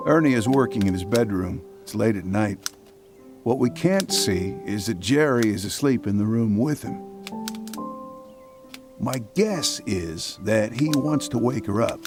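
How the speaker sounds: ordinary delivery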